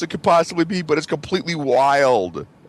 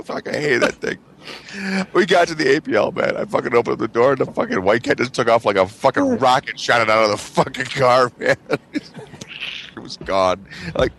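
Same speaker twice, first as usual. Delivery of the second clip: ordinary talk, nothing marked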